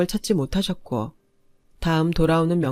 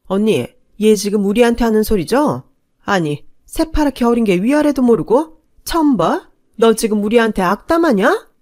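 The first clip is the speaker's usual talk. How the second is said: louder, sharply rising indignant retort; angry aunt; 4 semitones higher, 6 dB louder than usual. A narrator's reading. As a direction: bristle at the upstart daughter-in-law. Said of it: "저를 죽일 듯 노려보며 한마디씩 하셨어요". (조카며느리에게 화가 나 따지는 고모의 목소리)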